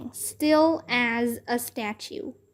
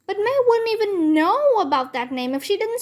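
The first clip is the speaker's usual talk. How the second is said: high, heated protest; shrill-free but sharply raised child protest; indignant child; 8 semitones higher than usual. Acted. Girl snapping back at May, high and heated.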